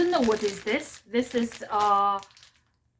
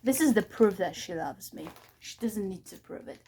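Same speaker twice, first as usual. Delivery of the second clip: ordinary talk, nothing marked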